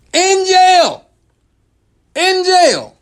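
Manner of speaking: high, loud outraged disbelief; incredulous yell. Acted. Comic telling the crowd about a guard's joke request, incredulous yell.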